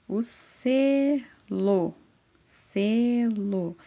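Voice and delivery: SELO very slowly, SE held long, then LO; split into syllables. Shown as a demonstration. Teacher revealing the answer to a child, "selo" drawn out by syllables. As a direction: stretch "selo" into se... lo. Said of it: "eu primeiro falo a sílaba".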